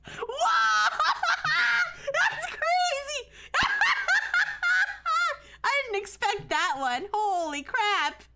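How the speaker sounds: high, loud shrieking laughter through the words; cracking up in real disbelief; shocked laughter. Real, not acted. Streamer stunned by Santa's naughty-list verdict, shrieking with laughter.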